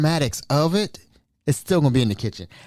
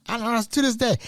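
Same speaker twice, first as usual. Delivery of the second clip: ordinary talk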